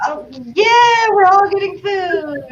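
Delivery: high-pitched mock enthusiasm; eager crowd. The group inviting itself to the couple's date, overexcited. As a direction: chime in like an excited tag-along group.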